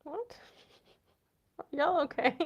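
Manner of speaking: ordinary delivery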